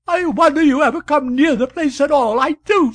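high, loud hysterical outburst; hysterical reproach. A narrator's reading. Wife at her husband after his late return, hysterical and loud. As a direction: fling it at him in tears.

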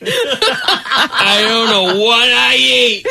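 slow drawn-out words through laughter; laughing. Real, not acted. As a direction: stretch it out while cracking up. Repeated, slower, through laughter.

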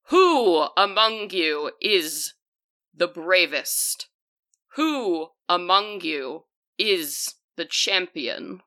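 slow, drawn-out challenge with long pauses; stately water spirit, stretching each question out; otherworldly water spirit. Acted. Water spirit addresses the adventurers from the lake, slow and solemn. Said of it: "she begins to speak in a voice".

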